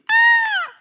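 very high loud scream; scared scream. Acted. Disciple seeing a ghost, high loud scream. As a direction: scream like a terrified sailor. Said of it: "they cried out in fear".

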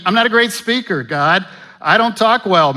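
high, loud protesting voice; Moses whining. Retold or imitated. Pastor voicing Moses telling God he can't speak, high whine. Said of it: "I mean".

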